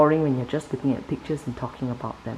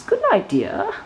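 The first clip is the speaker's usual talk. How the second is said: much higher, sweet wife's voice; zookeeper's wife. A narrator's reading. Reading a picture book to a child, high wife voice. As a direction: pitch it way up as the wife. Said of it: "Zookeeper's wife says".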